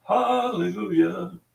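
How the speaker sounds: high, slow joyful hallelujah; drawn-out hallelujah. Real, not acted. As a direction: sing it out with real relief. Relief at service dog's final test.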